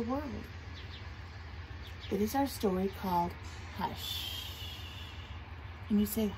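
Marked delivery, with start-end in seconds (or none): 3.8-4.5 whispered, drawn-out shush; soft hush. Shown as a demonstration. Teacher reading the story's title to children, soft hush. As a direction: breathe it out very softly.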